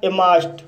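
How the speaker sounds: इमास्ट with no r and the ending as t; silent R, final T. Shown as a demonstration. Teacher adds ED to the verb for learners, silent R and final T. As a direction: end इमास्ट on a crisp t sound, r silent. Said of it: "आर silent हो जाता है और डी को टी".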